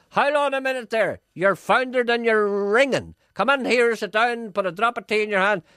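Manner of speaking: high, loud Belfast granny patter; singsong Belfast McCooey chatter. Shown as a demonstration. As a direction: rattle on like an old Belfast McCooey. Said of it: "people from Belfast sound like, sound like they're singing".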